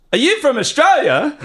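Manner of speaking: high, projected mock yell; super loud question. Customer questioning the barista making coffee, super loudly. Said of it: "super loudly".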